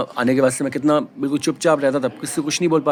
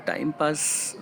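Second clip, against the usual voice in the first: drawn-out, much softer, amused echo of "time pass"; mocking repeat; 8 dB quieter, much slower than usual. Repeating the accusation to viewers, amused disbelief. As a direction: savour "time pass" slowly, half-laughing in disbelief. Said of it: "हल्की सी हंसी आ गई थी मुझे".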